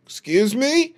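high rising startled question; startled excuse me. Real, not acted. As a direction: react like someone just spoke. Reacting to a sudden noise.

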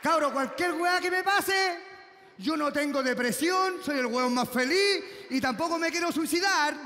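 slow, loud forced claim of happiness; loud insistent shout. Acted. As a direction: shout that you're fine, slowly and too loud. (grito forzado y lento de que está feliz)

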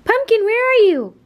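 high, loud call across the house. Real, not acted. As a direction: call the dog from afar. Calling for the dog.

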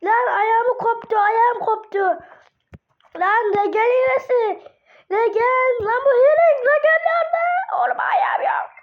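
high loud half-crying wail; mock wailing. Real, not acted. Player whose character lost a leg, hammed-up crying wail. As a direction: whine in hammed-up anguish over the lost leg.

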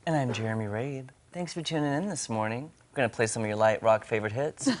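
soothing, soft radio voice; hushed, low easy-listening host; Delilah. Shown as a demonstration. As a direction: lull the listeners like a late-night DJ. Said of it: "That was my soothing radio voice".

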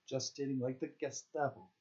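ordinary delivery, nothing marked

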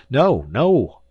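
loud, high, urgent protest; crying out. A narrator's reading. Visitor begs not to be dismissed, crying out urgently. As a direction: cry out to stop him. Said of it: "I cried".

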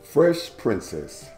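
ordinary delivery

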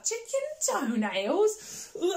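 high, slow, squeamish chicken toenails; ew, really, ham up the yuck; incredulous grossed-out question; mock disgust. Disgust at potion ingredient.

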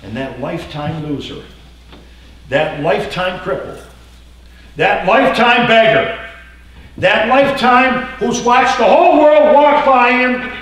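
rising from calm to full shout; building preaching. Real, not acted. Preacher building lifetime list.

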